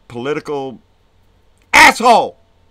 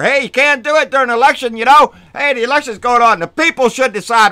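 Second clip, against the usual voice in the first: mocking McConnell; 6 semitones higher, 4 dB louder, faster than usual. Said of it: "that bullshit that Mitch McConnell spit out".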